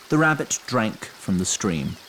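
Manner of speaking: ordinary delivery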